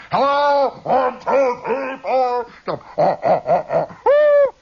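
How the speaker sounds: high, loud mic-test holler; yelling into mic. Retold or imitated. Kid playing with amplifier in his bedroom, high yelling. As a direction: whoop and bellow into a homemade amplifier. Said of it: "I'm yelling in there".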